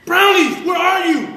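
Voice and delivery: high, loud desperate call; frantic shout. Retold or imitated. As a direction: yell for the brownies to answer. Kid yelling at silent brownies.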